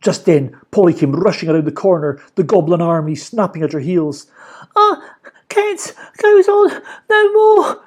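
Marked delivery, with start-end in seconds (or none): 4.8-7.8 put-on high, slow out-of-breath girl; panting. A narrator's reading. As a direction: gasp out the words. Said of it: "she panted".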